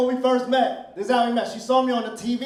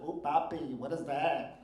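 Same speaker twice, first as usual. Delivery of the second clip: much lower and softer, breathy-free purr; quiet sultry coo; 7 semitones lower, 11 dB quieter than usual.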